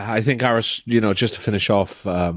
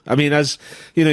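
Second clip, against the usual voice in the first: usual speaking voice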